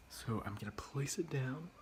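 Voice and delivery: soft whisper while working. Real, not acted. Placing patties in the pan, whispered to herself. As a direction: breathe it out quietly.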